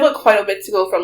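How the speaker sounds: ordinary delivery, nothing marked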